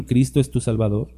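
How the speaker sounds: normal talking voice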